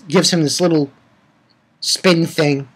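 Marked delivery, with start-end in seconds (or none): none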